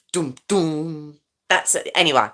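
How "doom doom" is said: low, soft mock rapper voice. Shown as a demonstration. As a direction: growly rap hook, played low and soft. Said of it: "doing my D M X impression".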